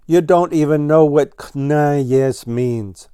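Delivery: a touch of scorn, pitch up on the start; disdainful. Acted. Ground Squirrel scoffs at Blue Jay, lightly disdainful. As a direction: look down on him. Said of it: "said Ground Squirrel disdainfully".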